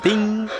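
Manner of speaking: high bright ding; ding sound effect. Pretend. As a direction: sing out a high pop-up bell ding. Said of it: "We just need a little pop-up sound".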